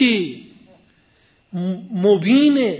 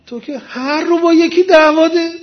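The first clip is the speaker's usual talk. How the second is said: raised, exasperated mock telling-off; acted scolding; 7 semitones higher, 7 dB louder than usual. Moses scolds the man who fights everyone, acted exasperation. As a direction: scold him in a higher voice, as in a retelling.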